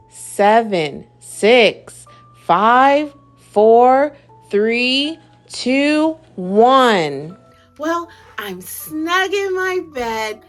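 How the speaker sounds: usual speaking voice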